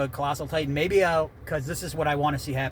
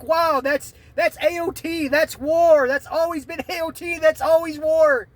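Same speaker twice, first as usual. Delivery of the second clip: awed fan; 10 semitones higher, 4 dB louder than usual. Retold or imitated. Fan imagining his reaction in high awed excitement. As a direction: marvel at it, hitting "always". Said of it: "be like".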